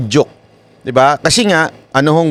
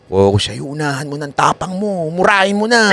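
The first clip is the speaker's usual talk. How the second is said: softer, hissing devil's urging; devil whisper; 3 dB quieter than usual. Retold or imitated. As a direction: whisper temptation in their ear. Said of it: "anong bubulong sa'yo ng demonyo". (bulong ng demonyo)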